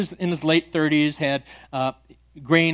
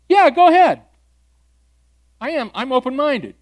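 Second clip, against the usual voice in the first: much higher, louder, breezy voice for the man; mock casual agreement; 8 semitones higher, 8 dB louder than usual. Pastor voicing the atheist agreeing to listen, breezy. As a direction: toss it off casually.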